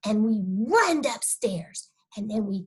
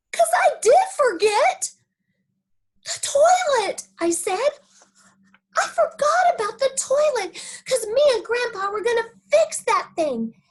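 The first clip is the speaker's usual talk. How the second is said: put-on much higher, louder excited kid; excited little girl; 11 semitones higher, 5 dB louder than usual. Junie B remembering the toilet, excited. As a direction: burst out with sudden excitement.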